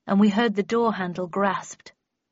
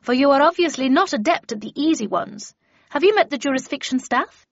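lightly voiced stern mentor, louder and higher; imperious Miss Havisham; 5 semitones higher, 6 dB louder than usual. A narrator's reading. Havisham quizzes her new pupil, lightly imperious. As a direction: lightly play an imperious older teacher.

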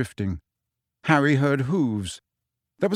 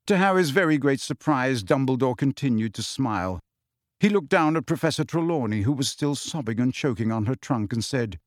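ordinary delivery, nothing marked

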